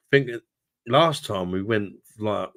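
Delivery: usual speaking voice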